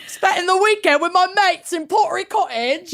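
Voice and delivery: high, hammed-up British accent all through; mock British accent. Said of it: "in a British accent".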